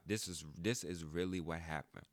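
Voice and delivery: ordinary talk, nothing marked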